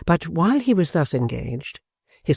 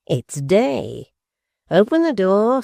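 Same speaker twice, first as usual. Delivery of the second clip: a leering dwarf, sweet and slow at the end; malicious grin; 5 semitones higher, slower than usual. A narrator's reading. Quilp to his wife at dawn, leering with a malicious grin. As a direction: grin through it, drawing out sweet. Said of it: "looking round with a malicious grin".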